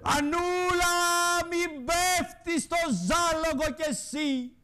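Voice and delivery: mock call out. The host calls out to Anna in mock warning. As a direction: shout her name, then warn her slowly.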